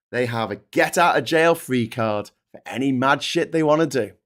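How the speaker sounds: ordinary talk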